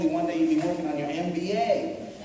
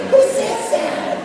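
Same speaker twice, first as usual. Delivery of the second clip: laughing; 5 dB louder, much slower than usual. Real, not acted. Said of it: "laughing at himself".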